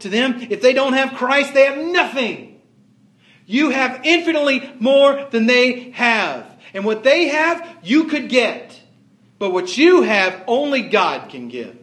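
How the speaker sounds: high, loud pulpit preaching; preaching at full force. Acted. Pastor urging the congregation not to envy the rich, impassioned preaching. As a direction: lift it up and drive it home to the pews.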